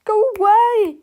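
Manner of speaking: high, loud order; commanding cry. A narrator's reading. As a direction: cry out to shoo them off. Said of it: "she cried".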